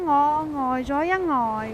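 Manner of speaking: usual speaking voice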